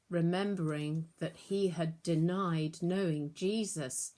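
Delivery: plain reading voice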